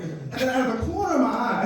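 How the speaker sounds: ordinary delivery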